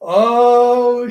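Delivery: loud, high, drawn-out "oh"; real loud, stretched exclamation; amused exclamation. Real, not acted. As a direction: blurt it out high after a laughing fit. Real reaction after laughing fit.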